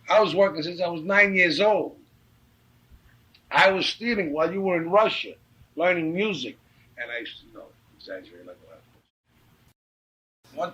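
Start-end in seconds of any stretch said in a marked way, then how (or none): 0.1-6.6 loud, higher-pitched indignant comeback; heated retort. Retold or imitated. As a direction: throw your hard childhood in his face. Retold heated argument with his companion.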